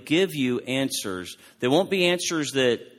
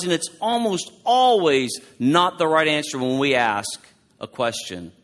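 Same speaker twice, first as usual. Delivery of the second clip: normal talking voice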